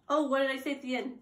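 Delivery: ordinary talk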